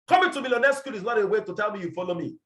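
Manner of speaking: usual speaking voice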